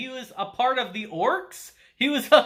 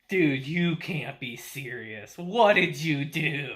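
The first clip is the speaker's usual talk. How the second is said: slow exasperated disbelief; 6 semitones lower, slower than usual. Retold or imitated. Takafumi disbelieving the uncle.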